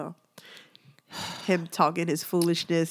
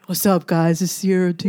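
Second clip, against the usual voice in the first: lower, husky intro greeting; deep husky voice. Shown as a demonstration. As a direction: say it like you sound like a dude. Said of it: "I hope I don't sound like a dude".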